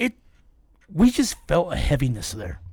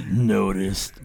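very low spooky machine voice; low ghostly machine imitation; scary voice box; 8 semitones lower than usual. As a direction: drop deep like an eerie spirit box. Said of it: "it says".

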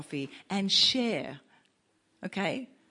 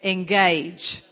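called out; 8 dB louder, slower than usual. Shown as a demonstration. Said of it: "Say it again".

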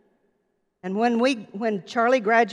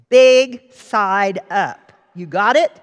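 slow, louder, deliberate scolding; stern boss; 10 dB louder, slower than usual. Retold or imitated. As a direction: spell it out like a grouchy boss. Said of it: "And he said".

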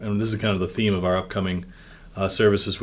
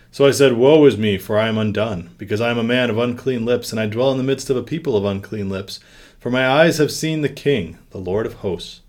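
loud, deliberate lectern reading of the lament; solemn reading, projected; proclaimed scripture; 3 semitones higher, 6 dB louder than usual. Acted. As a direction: read it out as from the pulpit. Read aloud from Isaiah.

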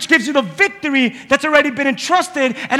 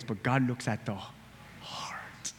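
hushed whisper, slowed right down; 14 semitones lower, 19 dB quieter, much slower than usual. Acted. Preacher whispers scripture to the congregation, hushed. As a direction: breathe the words out as a secret.

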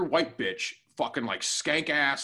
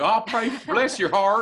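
slow, higher, louder church-lady sweetness; mock Southern piety; 7 semitones higher, 6 dB louder, slower than usual. Mocking co-host's call to pray, mock Southern piety. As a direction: lightly put on a Southern well-wisher. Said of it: "that's some Southern shit".

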